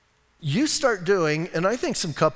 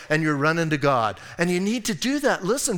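normal talking voice

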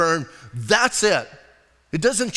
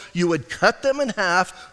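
ordinary talk

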